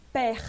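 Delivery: père drawn out, open E and sounded R; pronounced R. Shown as a demonstration. French teacher introducing a first name, final R sounded. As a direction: stretch père slowly into its French R. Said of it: "eu vou pronunciar esse R".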